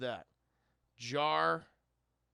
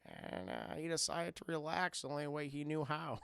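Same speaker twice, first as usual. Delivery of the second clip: lower, softer storyteller voice; a hint of George Lucas; 6 semitones lower, 8 dB quieter than usual. Streamer reads erotic fan fiction to chat, lightly doing George Lucas. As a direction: read it low and dry like the director. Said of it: "in the George Lucas voice".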